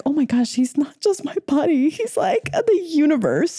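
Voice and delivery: high-pitched acted amazement, slowing as it goes; awestruck realisation. Acting out Arjuna realising who his buddy is, awestruck. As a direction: jump up in pitch, as if it just dawned on you.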